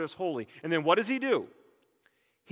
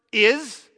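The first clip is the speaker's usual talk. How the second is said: "Is." set apart and pointed; stress on is; 4 semitones higher, 7 dB louder than usual. Shown as a demonstration. Preacher singling out the word "Is." with weight. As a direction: lift "Is." up high as the key word. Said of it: "That is one of the most hotly debated words".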